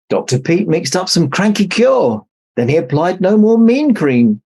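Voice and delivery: ordinary delivery, nothing marked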